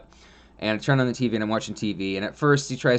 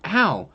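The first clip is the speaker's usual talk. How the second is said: high mock yelp of pain; imitating a hurt snake yelping; snake's ow; 8 semitones higher, 4 dB louder than usual. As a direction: squeak out a little ow for the snake. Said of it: "it went".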